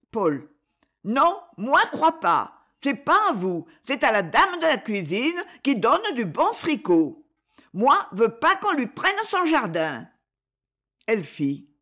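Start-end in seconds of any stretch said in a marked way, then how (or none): 1.0-10.1 put-on higher little boy, stubborn baby talk; a tiny boy defending the cook's garden; small child voice. (tout petit garçon têtu, voix aiguë)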